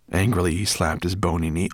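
usual speaking voice

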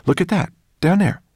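high-pitched, loud eagerness; breathlessly eager to show a friend; excited. A narrator's reading. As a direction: point it out excitedly. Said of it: "pointed down at the ground in excitement".

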